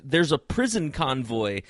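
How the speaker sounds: ordinary talk, nothing marked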